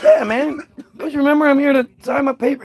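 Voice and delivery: high, easy-going greeting; casual high 'yeah man'; breezy. Pretend. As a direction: act like everyone knows you.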